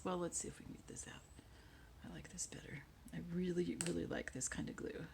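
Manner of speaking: soft unvoiced muttering to self; whispering. Real, not acted. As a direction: whisper it under your breath. Muttering at glue while working.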